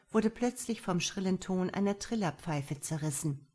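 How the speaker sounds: ordinary delivery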